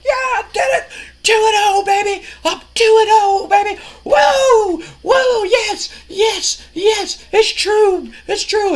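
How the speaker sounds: very high-pitched squealing celebration; super excited. Retold or imitated. Winning wrestler boasts to the crowd, super excited. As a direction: imitate a wrestler beside himself with glee. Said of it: "he's super excited".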